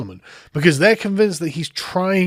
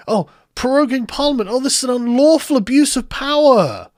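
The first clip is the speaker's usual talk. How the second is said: higher-pitched mocking complaint; mock outrage; 7 semitones higher than usual. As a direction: parody an indignant protest, pitched up. Mockingly voices Gina's complaint.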